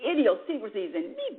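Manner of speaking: ordinary delivery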